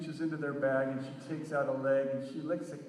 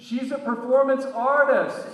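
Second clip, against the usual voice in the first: high, loud call out a window; yelling; 9 semitones higher, 12 dB louder than usual. Retold or imitated. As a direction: shout to be heard outside. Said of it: "I'm yelling out the bus window".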